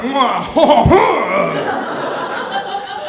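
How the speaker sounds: mock villain cackle, mwah ha ha; hammed-up evil laugh.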